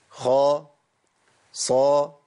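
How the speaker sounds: خا and صا both heavy and full; emphatic letters. Shown as a demonstration. Teacher drills students on heavy letters. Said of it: "نجيب تاني الخاء".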